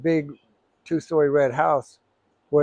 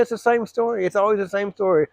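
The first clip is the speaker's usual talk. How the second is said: higher, scornful retort to an angry father; scornful mocking; 6 semitones higher than usual. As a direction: throw it back at dad with contempt. Son mocks father to his face.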